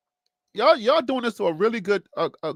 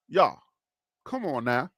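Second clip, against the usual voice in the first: put-on Florida accent; 6 semitones lower, 4 dB quieter than usual. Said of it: "I'm trying my best Florida accent".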